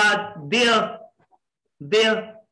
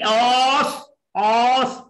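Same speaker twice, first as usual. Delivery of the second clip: ऑस alone, drawn out, then stop; isolated syllable; 4 semitones higher, much slower than usual. Shown as a demonstration. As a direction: say ऑस as one held beat, twice. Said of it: "आप एक ही मरोड़ में कहें". (सिर्फ़ ऑस, एक ही हिस्सा, रुक कर)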